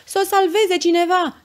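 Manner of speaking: high, loud plea from the crowd; alarmed shout. A narrator's reading. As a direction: cry out for someone to help. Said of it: "striga alt omuleț". (strigăt alarmat, pe ton înalt)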